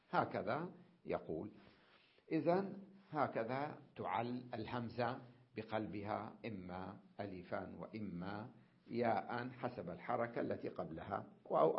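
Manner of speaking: ordinary talk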